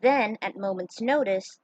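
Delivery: usual speaking voice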